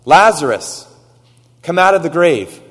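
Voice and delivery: loud high command into the tomb; commanding call. Retold or imitated. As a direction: call him out of the grave, loud. Jesus calls into tomb.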